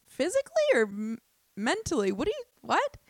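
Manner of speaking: high, halting acted confusion; baffled questioning. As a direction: ask it incredulously, pitch leaping up. Acts out confusion at the challenge.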